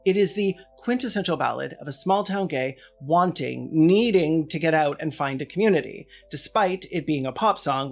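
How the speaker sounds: usual speaking voice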